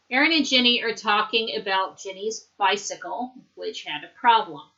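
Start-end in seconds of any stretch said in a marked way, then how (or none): none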